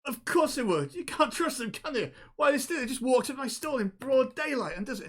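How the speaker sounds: high-pitched, heated protest; acted indignation, voice jumping up high; indignant accuser. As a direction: rant as an outraged stallholder. Gnome stallholder arguing with the accused.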